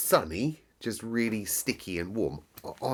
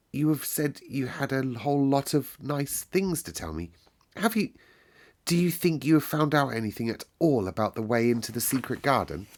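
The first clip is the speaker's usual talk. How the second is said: put-on boy's voice; 6 semitones higher than usual. A narrator's reading. Colin asks eagerly.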